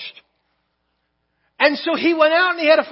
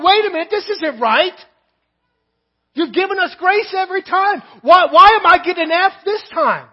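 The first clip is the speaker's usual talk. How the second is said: louder, higher, wounded protest; indignant protest; 4 semitones higher, 6 dB louder than usual. Retold or imitated. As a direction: argue it's not fair, voice rising. Student protests his failing grade.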